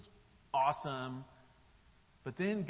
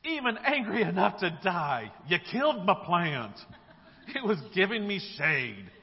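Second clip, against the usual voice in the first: loud, indignant outburst; aggrieved yelling, pushed hard; angry retort; 3 semitones higher, 7 dB louder than usual. Acted. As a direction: shout your grievance at God. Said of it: "Jonah retorted".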